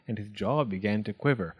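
ordinary delivery, nothing marked

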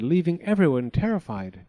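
plain reading voice

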